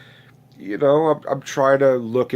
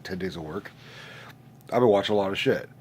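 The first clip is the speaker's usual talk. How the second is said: normal talking voice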